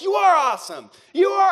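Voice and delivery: high mock hype-up yell; imitated team shouting. Sports teammates hyping each other up, imitated shouting. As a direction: pretend to rile up a teammate, voice pitched high. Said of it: "you start shouting at each other".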